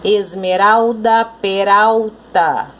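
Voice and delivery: Esmeralda and peralta slowly drawn out; rhyme demonstration. Shown as a demonstration. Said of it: "o som final da palavra é semelhante".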